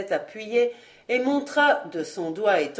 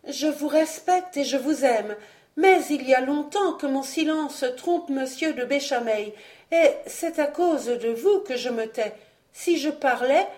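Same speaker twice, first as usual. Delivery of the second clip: voix retenue; 5 semitones higher than usual. Acted. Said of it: "en retenant sa voix qui voulait éclater".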